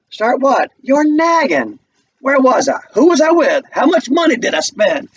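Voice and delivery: irritated Walter. Acted. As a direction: fend off a nagging wife. Walter snapping at Ruth.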